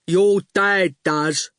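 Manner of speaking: slow, placid goon voice; slow daft goon. A narrator's reading. Goon answers Howard, slow and placid. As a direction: drawl it calmly.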